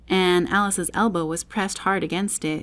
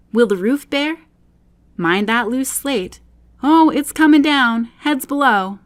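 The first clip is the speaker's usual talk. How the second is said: louder, higher calls between workmen; 5 semitones higher, 5 dB louder than usual. Acted. Workmen shouting to each other while raising ladders, loud. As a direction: call out across the roof.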